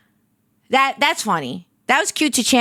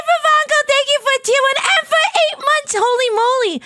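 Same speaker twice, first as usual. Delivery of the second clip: very high squealed amazement; excited thanks; 13 semitones higher than usual. Real, not acted. Streamer thanking an eight-month subscriber, excited squeal. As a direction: squeal it high, thrilled by the support.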